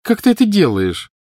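put-on high-pitched envious child's question. A narrator's reading. Petunia asking Lily about her magic, envious. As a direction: ask with grudging envy, pitched high. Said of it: "с явной завистью в голосе".

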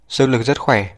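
plain reading voice

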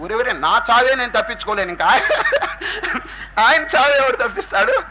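put-on amused old father chuckling at his son; laughing father. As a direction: laugh off the absurd demand. Father scoffs at son's condition.

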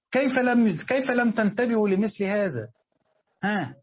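high, loud, then slow stunned reproach; exasperated disbelief. Real, not acted. Teacher scolds students for missing the point, exasperated and high. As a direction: rebuke the class, amazed they missed it.

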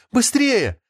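high-pitched urging cry. A narrator's reading. Sveta urging Artyom on as guards chase, crying out. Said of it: "кричала Света".

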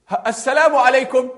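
high, loud call; shouted greeting. Acted. Man greeting unseen stranger in forest, shouted greeting. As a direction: cry the greeting out into the woods. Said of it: "er hätte geschrien".

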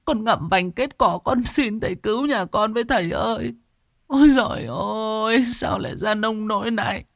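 a widow begging through tears; high sobbing plea; desperate pleading. A narrator's reading. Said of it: "van xin".

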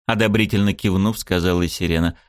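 ordinary delivery

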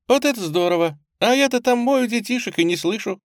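put-on high, excited girl's voice; beaming delight. A narrator's reading. Girl overjoyed to see Uncle Bob, beaming. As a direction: burst out happily, pitched high. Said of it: "просияла она".

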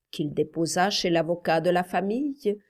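usual speaking voice

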